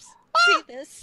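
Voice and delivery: very high, loud playful shriek; mock scream. Player redoes Lily's call as suggested, mock scream. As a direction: shriek it out in pretend horror. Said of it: "a little bit of a scream there too".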